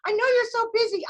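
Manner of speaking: put-on a faint, playful Spanish accent, said again; mock Spanish accent. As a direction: keep teasing in a slight Spanish accent. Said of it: "I'm working on my Spanish accent".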